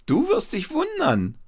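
put-on very high-pitched boyish call. A narrator's reading. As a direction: shout out a cheeky promise. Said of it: "rief Paul". (mit hoher Jungenstimme rufen)

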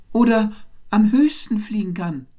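very high, loud little-bird voice; calling out in interruption. A narrator's reading. Wren cuts into the birds' debate, calling out high. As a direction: pipe up loudly, right at the top of your voice. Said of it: "rief der Zaunkönig dazwischen".

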